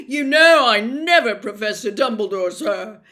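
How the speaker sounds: desperate appeal to the headmaster; imploring. Acted. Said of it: "looking imploringly".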